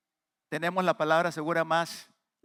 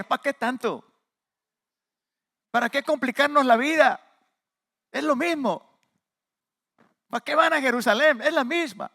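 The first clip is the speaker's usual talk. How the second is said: higher, scoffing know-it-all king; Jeroboam's voice; 7 semitones higher, 5 dB louder than usual. Retold or imitated. Preacher voicing Jeroboam to the people, higher mock scoff. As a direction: brush it off as needless fuss. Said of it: "Jeroboam decía".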